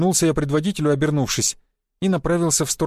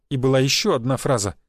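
plain reading voice

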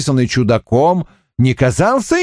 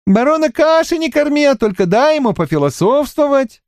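teasing; 12 semitones higher than usual. Acted. Solyony teasing the baron.

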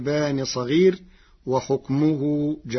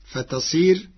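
ordinary delivery

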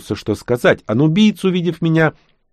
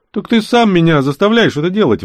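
sharp indignant outburst, higher and louder; 9 semitones higher, 4 dB louder than usual. Acted. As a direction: protest hotly, feeling wronged. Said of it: "возмутился я". (возмущённо)

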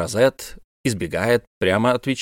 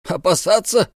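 astonished question, pitch rising higher; 8 semitones higher than usual. A narrator's reading. Captain echoes the professor's warning in astonishment. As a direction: echo the word in disbelief. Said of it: "изумился капитан Сервадак".

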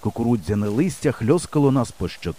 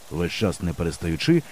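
ordinary delivery

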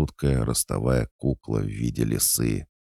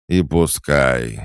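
put-on slow, louder drunken give-up; exhaled resignation; 3 semitones higher, 7 dB louder, slower than usual. Drunk cat told he'll be hanged, breathing out resignation. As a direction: heave it out, past caring. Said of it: "выдохнул кот Баюн".

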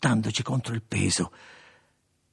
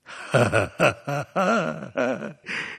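noisy sardonic cackle; sardonic laugh; 3 semitones higher than usual. A narrator's reading. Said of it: "facendo una rumorosa risata sardonica". (rumorosa risata sardonica)

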